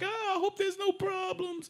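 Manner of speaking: high put-on worried woman; mock worried woman. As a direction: fret in a falsetto.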